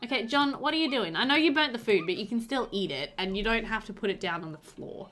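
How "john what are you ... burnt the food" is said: usual speaking voice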